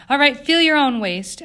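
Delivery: loud, high-pitched order; exasperated insistence. A narrator's reading. Eleanor telling Jane to feel her waist, exasperated insistence. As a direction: insist sharply, well above normal voice.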